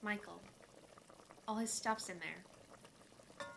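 low-key, soft and downcast; subdued admission. Acted. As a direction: say it quietly, reluctant to explain. Daughter explains painful empty room.

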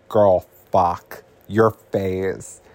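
put-on slow, drawn-out "girl" then blunt; blunt shocked remark. [name] tells Tracy about her bruised face, slow and blunt. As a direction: drag it out in disbelief.

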